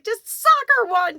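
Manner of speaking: high, loud, drawn-out urging; egging on. Retold or imitated. Friend urges crying woman to strike, high loud egging on. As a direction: goad loudly, stretching the words.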